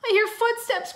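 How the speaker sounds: put-on high, loud panicky child; little boy's scared squeal; frightened cry. A narrator's reading. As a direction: cry out in alarm. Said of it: "cried Timmy".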